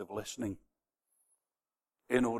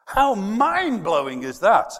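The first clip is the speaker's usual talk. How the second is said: louder and higher, slow; preacher marvelling at the church; amazed exclamation; 7 semitones higher, 12 dB louder, slower than usual. Real, not acted. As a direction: marvel out loud.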